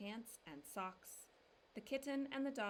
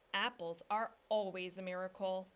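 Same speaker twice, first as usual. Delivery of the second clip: louder, a lightly voiced proud papa; joyful declaration; 7 dB louder than usual. A narrator's reading. Papa declaring it as he tosses the apples down, louder. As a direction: declare it grandly. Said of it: "declared Papa as he joyfully".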